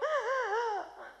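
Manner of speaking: high gasp of someone bawling; imitated sobbing gasp. Shown as a demonstration. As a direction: imitate gasping through uncontrollable tears. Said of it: "pero ese llanto que como".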